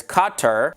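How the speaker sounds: Qatar said as KAH-ter; Qatar with open first vowel, reduced ending; variant Qatar pronunciation. Shown as a demonstration. Listing common ways to say Qatar, pronounced KAH-ter. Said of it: "common ways of pronouncing it are".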